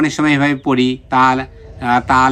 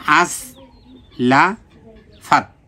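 "আস, লা, ফাত" in separate pieces, pauses between; syllable by syllable; much slower than usual. Shown as a demonstration. Teacher sounds out the word for learners, "আস, লা, ফাত" syllable by syllable. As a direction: break "আস, লা, ফাত" apart with clear gaps. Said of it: "সবগুলো তাড়াতাড়ি".

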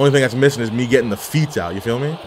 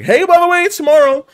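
much higher, bright mock announcement; 4 dB louder than usual. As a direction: chirp it like a surprise notice. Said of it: "springing that, like, randomly".

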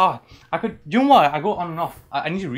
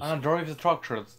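West Country attempt with rounded vowels; West Country accent; 3 semitones higher than usual. Shown as a demonstration. Repeating after a friend, West Country accent. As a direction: copy the West Country burr. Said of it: "Southern England".

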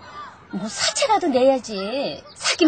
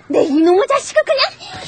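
put-on loud furious outburst at the boy; furious mother's threat; angry threat; 5 semitones higher, 4 dB louder than usual. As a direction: bark the threat, voice shooting up. Threatening the bully.

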